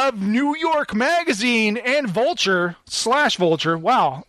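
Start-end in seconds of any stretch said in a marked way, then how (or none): none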